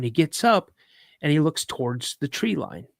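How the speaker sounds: usual speaking voice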